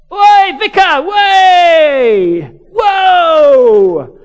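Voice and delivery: very loud, high drawn-out holler; Cockney urchin yell. Retold or imitated. Cockney kid jumping up over the fence, urchin yell. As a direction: bellow it like a Cockney street kid. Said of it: "Real Cockney urchin type".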